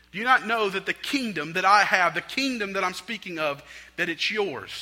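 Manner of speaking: loud, high, forceful preacher voice; impassioned preaching. Acted. Preacher telling worriers the kingdom is theirs, impassioned preaching. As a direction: drive it home to the congregation.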